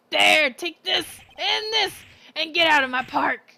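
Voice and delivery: put-on angry old man. Old man yelling at pigeons, angry. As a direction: shout him off the pigeons.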